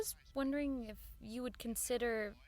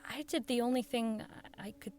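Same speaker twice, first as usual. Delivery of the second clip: story narration. A narrator's reading. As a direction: linger on the memory. Narrator closes the scene.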